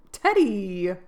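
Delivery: loud startled call of his name; surprised cry. A narrator's reading. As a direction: call out, caught off guard. Jo exclaims.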